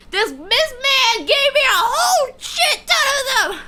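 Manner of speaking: high, loud, laughing through it; half laughing, half wailing; laugh-crying shout. Real, not acted. Laughing complaint about friend.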